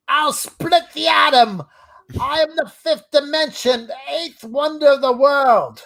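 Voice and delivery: high, slow, grandiose character voice; Jeff's boast. Acted. Co-host acts Jeff's famous quote, high proclaiming voice. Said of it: "He goes".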